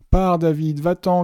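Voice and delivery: high, loud urgent shout; cri. A narrator's reading. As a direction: shout at him to get away. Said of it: "cria Jill". (cri pressant, aigu et fort)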